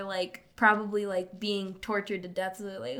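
ordinary talk

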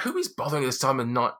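wife annoyed at being disturbed so late; indignant wife. Acted. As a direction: huff at the late intrusion. Wife repeats at door.

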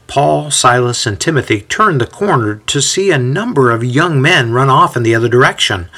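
plain reading voice

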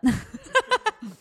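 a high, far-off cackle for Meemaw; high cackling laugh. Retold or imitated. Meemaw laughing at her on the phone, high cackle. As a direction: burst into a high hooting laugh.

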